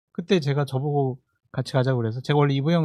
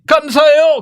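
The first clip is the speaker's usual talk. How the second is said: high, loud, bright thank-you; own voice mimicked; 12 semitones higher, 11 dB louder, slower than usual. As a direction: squeal a very polite thanks. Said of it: "그랬어요, 제가".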